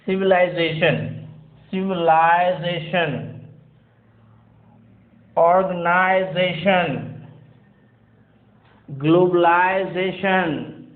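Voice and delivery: civilization, organization, globalization with a long "eye", said slowly; slow British -isation words; British pronunciation. Shown as a demonstration. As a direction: stretch each word with a clear eye-zay. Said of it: "this word will be pronounced as".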